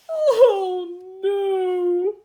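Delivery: high, loud wail; cry of dismay. Acted. George finding the shop closed, loud high wail. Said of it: "cried George".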